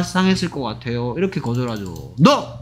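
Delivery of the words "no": short, high-pitched no; blunt refusal. Shown as a demonstration. Teacher shows how not to refuse, blunt refusal. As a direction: cut them off with a curt no. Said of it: "이렇게 거절하진 않겠죠".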